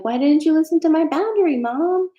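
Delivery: high, loud mock whine at mom; hammed-up whining to her mother; whining wail. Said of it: "wailing and whining and being like".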